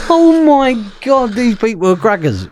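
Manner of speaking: high, slow astonished exclamation; recalling shock at the robbers' plan; amazed disbelief. Retold or imitated. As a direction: stretch it out in shock.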